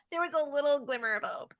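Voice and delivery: soft, sing-song mimicry of the teacher; mocking echo. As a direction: parody her sweet tone, hushed. Mocking echo, changed timbre.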